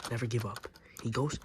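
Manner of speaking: ordinary talk, nothing marked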